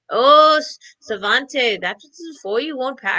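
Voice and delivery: put-on a barely-there Irish accent, given up on; half-hearted try at an Irish grandmother saying; abandoned attempt at an Irish accent; failed Irish accent. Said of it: "I can't even do his Irish accent".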